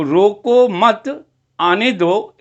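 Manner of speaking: रोको मत as one phrase, then आने दो apart; pause after मत. Shown as a demonstration. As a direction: keep मत with रोको, break before आने दो. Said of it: "कॉमा के प्लेस को चेंज किया है". (मत के बाद विराम देकर आने दो)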